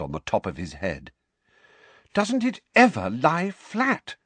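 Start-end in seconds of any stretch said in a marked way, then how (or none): 2.1-4.2 high, louder, slow exasperated plea; desperate. A narrator's reading. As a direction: despair over unruly hair. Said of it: "she said desperately".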